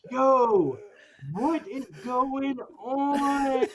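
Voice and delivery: mock startled Yo, then a long stretched question. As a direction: play walking in on chaos. Retelling walking in on the mess.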